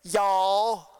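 Southern accent on Y'all, loud and proud. Shown as a demonstration. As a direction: say it like a true Southerner. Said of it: "trying to give me a proper Southern accent".

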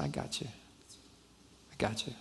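very soft, tender reassurance; hushed reassurance. Retold or imitated. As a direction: voice God's comfort almost under the breath. God's reassurance to friend, retold.

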